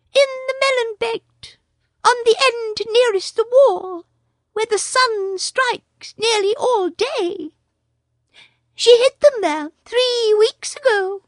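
a small proud bird boasting; Darzee the tailorbird. A narrator's reading.

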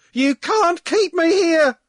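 very high, loud, slow shriek; screaming. Acted. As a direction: shriek it out in protest. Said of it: "Aloysius screamed".

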